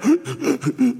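mock gasp. Fleeing king out of breath.

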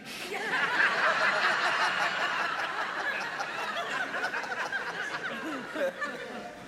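long high laugh; big laugh. Real, not acted. Laughing at his own joke.

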